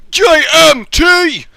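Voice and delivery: high, drawn-out shouted initials; booming shout. Pretend. Announcing who sent the question, big drawn-out shout. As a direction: bellow the name slowly, letter by letter.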